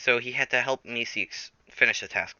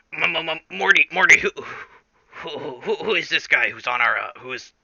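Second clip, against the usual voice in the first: stammering, higher-pitched cartoon scientist impression; Rick; 3 semitones higher than usual. Rick asks Morty who the stranger is, stammering Rick impression. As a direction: stutter over the words, pressing the question. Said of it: "And Morty's like".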